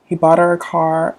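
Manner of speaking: normal talking voice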